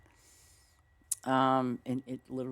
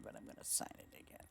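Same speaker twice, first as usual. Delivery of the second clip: whispered aside; 4 semitones lower, 17 dB quieter, much faster than usual. Real, not acted. Whispered aside while signing.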